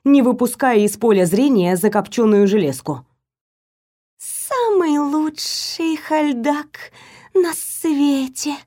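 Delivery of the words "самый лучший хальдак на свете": slow, high hissing serpent voice; devoted report. A narrator's reading. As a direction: proudly report like a loyal serpent. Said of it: "преданно отрапортовал Вейр". (преданный доклад змеи)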